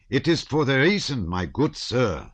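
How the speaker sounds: put-on a stout German officer in a thick German accent. A narrator's reading. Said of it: "speaking with a German accent".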